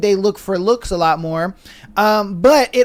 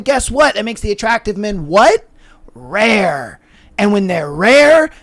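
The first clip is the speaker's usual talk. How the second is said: normal talking voice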